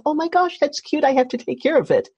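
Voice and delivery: ordinary delivery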